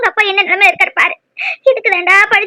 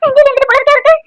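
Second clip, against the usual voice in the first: panicked calling; 6 semitones higher than usual. Acted. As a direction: cry out in alarm for the husband. Wife finds husband collapsed. (பதற்றமான கூச்சல்)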